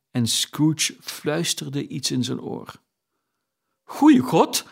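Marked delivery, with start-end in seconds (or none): 3.9-4.7 breathless, much higher cry of shock; stunned old gentleman crying out breathlessly; gasped exclamation. A narrator's reading. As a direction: gasp it out as if winded. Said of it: "riep de heer, alsof hij buiten adem was".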